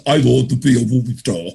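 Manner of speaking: imitated thick Schwarzenegger-style Austrian accent; horrible accent. Speaker mimics Arnold announcing his film ambition, horrible Austrian accent. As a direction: put on a heavy Austrian accent. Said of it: "got a horrible accent".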